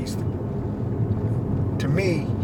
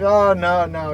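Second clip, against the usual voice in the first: lightly imitated boxer on the phone, louder and higher; Mike Tyson; 4 semitones higher, 6 dB louder, slower than usual. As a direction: retell his protest with a hint of his voice. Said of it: "this guy don't talk like that".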